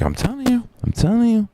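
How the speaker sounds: high-pitched mock insistence; imitated insistent believer. Believer insisting to a doubting friend, high mimicked voice.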